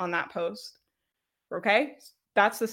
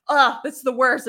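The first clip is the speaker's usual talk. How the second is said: drawn-out, louder groan of disdain; mock disgust; 3 semitones higher, 5 dB louder than usual. Coach voicing people who dread making videos, mock disgust. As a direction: groan it out slowly as a hater.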